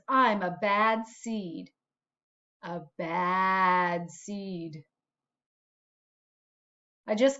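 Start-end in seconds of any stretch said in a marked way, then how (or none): 2.6-4.8 low, slow, spooky whisper-like repeat; ominous low echo. Acted. The seed telling the class he is bad, low and slow. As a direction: drop the voice low and drag it out.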